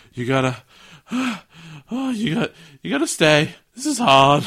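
sighing, straining mock exhaustion; hammed-up labouring in the heat; struggling with effort. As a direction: heave out the words between puffs. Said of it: "'cause it's hot and it's difficult".

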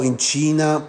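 usual speaking voice

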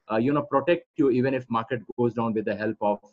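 ordinary talk

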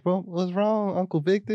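put-on high, childlike voice asking his uncle; kid voice. Nephew asking uncle Victor what's wrong, in a high childlike voice. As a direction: play a little kid looking up at his uncle. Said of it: "It's like".

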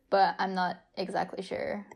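normal talking voice

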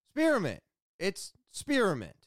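high-pitched, falling hard on each "spearmint"; baffled insistence. Retold or imitated. Shop worker explaining spearmint to baffled customer, exasperated insistence. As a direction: repeat it as if it were obvious, pitch swooping down.

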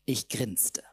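ordinary delivery

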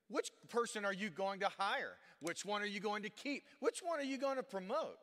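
building preacher fervor. Real, not acted. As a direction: push each question up, building to the last. Rising rhetorical questions to congregation.

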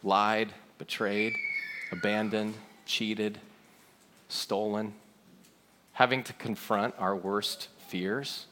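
low, soft, spaced-out words; hushed, solemn listing. Real, not acted. Preacher naming the failures we all understand, hushed and solemn. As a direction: weigh each item quietly.

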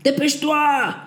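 high, loud barked command; shouted order. A narrator's reading. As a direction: hurry them with a yell. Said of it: "Sergeant Petrov kept at it".

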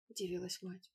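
plain reading voice

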